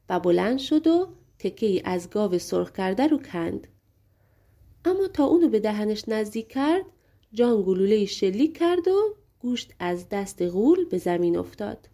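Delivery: plain reading voice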